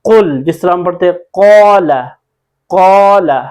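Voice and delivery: firm recited कुल and stretched कौला; weight the throaty "q" in कुल and कौला; full articulation. Shown as a demonstration. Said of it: "पूरे तलफ़ुज़ के साथ पढ़ेंगे".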